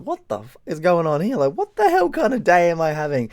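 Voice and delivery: acted disbelief. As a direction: replay your exasperated what-is-happening reaction. Retells his baffled reaction to fight.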